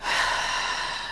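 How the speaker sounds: slow audible exhale, no voice; demonstrated deep exhale; long breath out. Shown as a demonstration. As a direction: let the breath go out slowly. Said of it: "Take a deep breath in".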